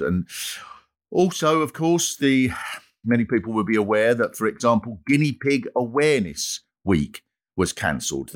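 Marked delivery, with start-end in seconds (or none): none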